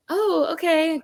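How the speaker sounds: imitating mom's high, uneasy okay; mom humoring. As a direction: play the mother pretending all is fine. Mom humoring the child's ghost.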